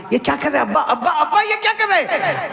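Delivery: high, laughing call of ABBA; Fatima calling out. Acted. Fatima surprised at her father, calling high with a laugh.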